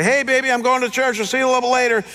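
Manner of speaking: calling out. Retold or imitated. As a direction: call out to your wife from the door. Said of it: "I'll yell to Kim".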